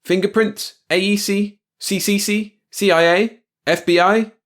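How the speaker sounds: high, loud quick-fire questions; shouted interrogation. A narrator's reading. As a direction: press item after item. Security officer interrogates by shouting.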